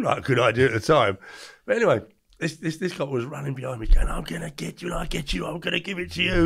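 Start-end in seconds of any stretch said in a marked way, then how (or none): 4.1-6.4 higher, menacing chase taunt; lightly voiced angry policeman; threatening cop.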